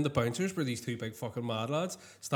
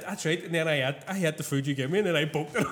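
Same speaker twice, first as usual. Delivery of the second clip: ordinary delivery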